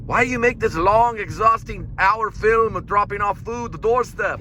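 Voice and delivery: high, slow put-on German accent; mock German filmmaker voice. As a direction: scold Cole like an exasperated German director. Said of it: "it's a German accent".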